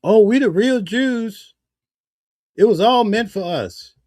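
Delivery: mock Hebrew Israelite. Mocking Hebrew Israelites' claim.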